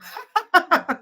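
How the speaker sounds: high burst of real laughter; amused high laugh. Real, not acted. Laughs after joking with viewers.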